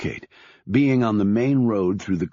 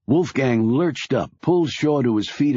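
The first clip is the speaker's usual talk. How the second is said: usual speaking voice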